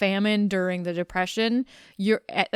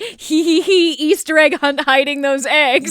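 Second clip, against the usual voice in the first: giggling monster; 4 semitones higher, 6 dB louder than usual. Retold or imitated. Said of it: "He's just like".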